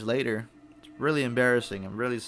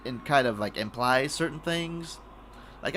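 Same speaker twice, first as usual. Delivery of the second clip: normal talking voice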